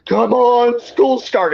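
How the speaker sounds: high, loud plea to hurry up; impatient pleading. Retold or imitated. Grounded teen urging the county on, impatient pleading. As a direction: cry out in mock desperation. Acting out his impatient teenage self.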